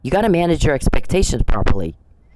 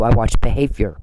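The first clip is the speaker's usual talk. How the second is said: usual speaking voice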